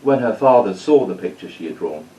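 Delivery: put-on lively read-aloud storytelling for kids; story narration. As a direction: tell it like a bedtime story to children.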